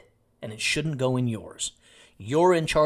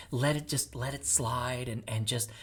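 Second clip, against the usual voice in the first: mock soft advice; 2 semitones lower, 6 dB quieter than usual. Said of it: "people are going".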